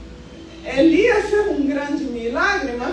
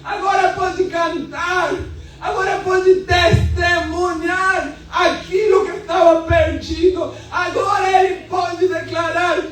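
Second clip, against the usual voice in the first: fiery preaching; 4 semitones higher than usual. Acted. Preacher proclaiming freedom to the church, full-force preaching. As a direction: preach it out to the congregation with fire.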